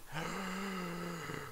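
long, soft high gasp of suspense; drawn-out mock gasp. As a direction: suck in breath as if awaiting news. Mock suspense over city falling.

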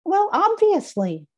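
high, slow, squeaky and smug; a toy emu stating the obvious. Acted. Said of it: "said Emmy".

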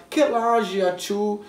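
slow, careful French phrases; slow learner French. Shown as a demonstration. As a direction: spell out the French phrases slowly. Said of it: "aprendendo a se apresentar".

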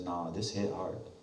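hushed, heartfelt prayer to God; sincere prayer. Acted. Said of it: "Intellectually says".

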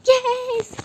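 loud, high cry of victory; triumphant shout. Real, not acted. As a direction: shout yes in relief and triumph. Finally gets past the obstacle. (zafer çığlığı)

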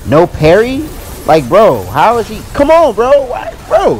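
loud, high-pitched disbelief; exasperated outburst. Real, not acted. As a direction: protest the unfair game, voice shooting way up. Enraged that parry failed in game.

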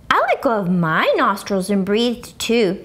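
plain reading voice

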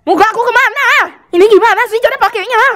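startled high exclamation; surprised. Real, not acted. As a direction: yelp of surprise. Camera filter hides face.

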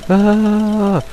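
high panicked cries; scared horror game streamer. Real, not acted. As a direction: yelp as it chases you again. Shell chases again.

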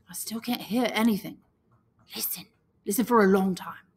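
breathy hush, words barely voiced; scared boy hushing his friend in the dark; frightened whisper. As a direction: whisper so the beast won't hear. Whispering in fear of beast.

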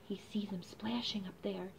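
hushed whisper. Real, not acted. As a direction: whisper it like a secret. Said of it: "whispered aside to listeners".

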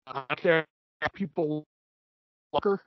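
choppy, breaking-up call audio; like a glitchy phone line; scrambled voice. Real, not acted. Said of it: "You're breaking up so bad".

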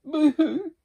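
very high, loud echo of crying; distant crier's high boo-hoo; echoed mock boo-hoo. A narrator's reading. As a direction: call back the pretend wail from across the pond. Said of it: "said the voice from across the pond".

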